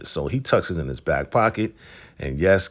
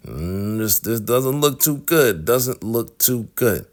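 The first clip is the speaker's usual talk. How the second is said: higher, slow and drawn-out uneasy mutter; worried dread; 6 semitones higher, slower than usual. Retold or imitated. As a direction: stretch it out like you know you're caught. Hosts fear being caught out.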